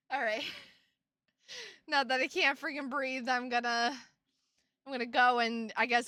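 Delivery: ordinary talk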